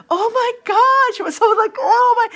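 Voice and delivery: high, loud gasping oh my God; shocked delight. Retold or imitated. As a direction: squeal it in disbelief. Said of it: "She was like".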